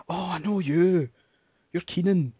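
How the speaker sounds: put-on high, excited Aberdeenshire accent; mock the local woman's broad Doric; local accent impression. Imitating the local woman recognising him, in her Aberdeenshire accent. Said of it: "Great impression of the accent there".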